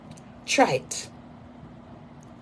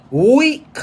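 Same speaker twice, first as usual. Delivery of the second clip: far higher, louder mocking cry; shrieked jeer; 10 semitones higher, 8 dB louder than usual. Real, not acted. [name] mocking him for needing women, shrieking jeer. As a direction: stretch it out to mock him. Taunting rival as weak.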